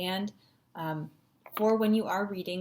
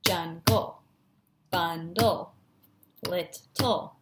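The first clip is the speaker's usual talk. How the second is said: JUNGLE, BUNDLE, LITTLE, each broken in two; split into syllables; 3 semitones lower, much slower than usual. Shown as a demonstration. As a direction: chunk each word: jun-gol, bun-dul, lit-tul. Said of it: "focusing on those chunks".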